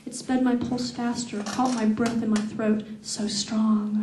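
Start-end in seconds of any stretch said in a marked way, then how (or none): none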